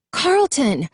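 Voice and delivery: high desperate call of a name; crying out. A narrator's reading. Jason crying out for his missing friend, high desperate call. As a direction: cry the name out in anguish. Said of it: "Jason cried out again".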